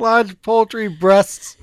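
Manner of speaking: squeezing words through laughter; laugh-shouting, slowly. Real, not acted.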